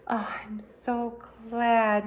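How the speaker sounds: slow, whispery and relieved; breathy relieved sigh. A narrator's reading. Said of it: "long sigh".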